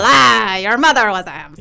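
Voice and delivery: loud mock French accent, hammed-up; Monty Python French accent. Goblin taunting the players in a loud mock French accent. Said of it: "they're all Monty Python French".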